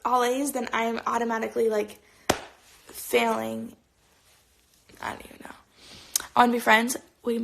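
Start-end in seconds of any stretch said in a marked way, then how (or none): 5.0-5.6 whispering. Real, not acted. Unsure trailing aside.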